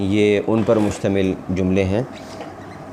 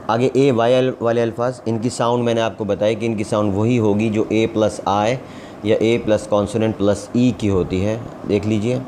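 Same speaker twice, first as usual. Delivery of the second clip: normal talking voice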